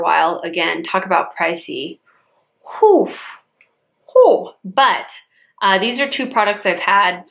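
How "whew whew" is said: whistle of disbelief. Real, not acted. Reacting to pricey products.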